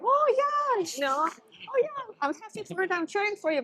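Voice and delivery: high-pitched imitation of delighted supporters; mock happy cheering. Imitating voters pleased at job cuts, mock cheering. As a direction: cheer them on in a put-on high voice. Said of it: "probably they were happy".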